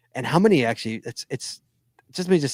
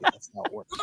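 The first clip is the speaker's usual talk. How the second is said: ordinary delivery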